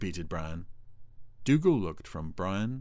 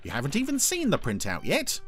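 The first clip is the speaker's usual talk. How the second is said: high, lively objection; chirpy high character voice; protesting Brian; 8 semitones higher, 4 dB louder than usual. A narrator's reading. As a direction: point it out cheekily up high.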